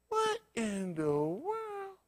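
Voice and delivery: high, slow, drawn-out amazement; stunned disbelief. Retold or imitated. Preacher telling the flock his own stunned disbelief. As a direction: gape at it in slow wonder. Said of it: "saying to myself".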